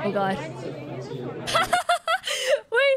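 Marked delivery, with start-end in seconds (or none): 0.4-3.0 high, flustered laugh-talk; awkward laughing. Real, not acted. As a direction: giggle through it, embarrassed. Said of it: "I'm so awkward".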